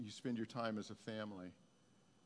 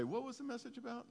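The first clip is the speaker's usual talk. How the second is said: lightly voiced bored churchgoer asking; mock churchgoer question; 12 semitones higher than usual. A hint of a churchgoer at lunch, mock puzzled. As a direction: toss it off like a lunch guest. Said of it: "and say".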